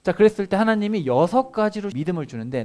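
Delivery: ordinary talk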